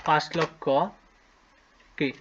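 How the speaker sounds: slow, drawn-out dictation with long pauses; dictating slowly. Shown as a demonstration. Teacher dictating to pupils, slow with long pauses. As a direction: spell out each part of the number slowly for the pupils. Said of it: "লিখে ফেলি".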